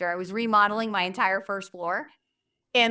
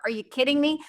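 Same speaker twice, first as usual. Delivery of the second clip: ordinary talk, nothing marked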